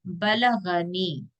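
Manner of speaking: बलगनी drawn out slowly, syllable by syllable, short vowels; one short vowel each. Shown as a demonstration. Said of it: "तो एक एक हरकत ही करेंगे".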